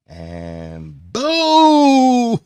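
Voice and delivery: high, loud drawn-out "boom!"; excited explosive boom; boom sound effect. Acted. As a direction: let the boom ring out long.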